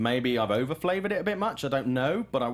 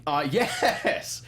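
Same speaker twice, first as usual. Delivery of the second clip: sheepish laugh into the yes; 4 semitones higher, 3 dB louder than usual. Real, not acted. Caught out as the loud laugher, laughing. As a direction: laugh as you admit it.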